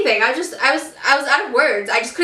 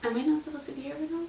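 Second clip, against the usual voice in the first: soft confused question; 2 semitones higher, 14 dB quieter, much faster than usual. Retold or imitated. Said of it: "I was like".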